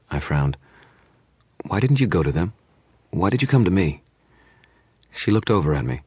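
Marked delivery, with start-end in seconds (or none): 1.6-4.0 raised, insistent questions; pressing questions. A narrator's reading. Detective pressing client, raised and insistent. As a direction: push her for answers. Dresden presses Monica, frowning.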